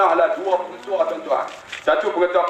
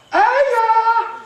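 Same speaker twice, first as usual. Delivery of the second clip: much higher, louder long call; child calling out; 4 dB louder than usual. Acted. Daughter arriving home calling her father: high loud call. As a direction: shout it across the house like a girl coming home.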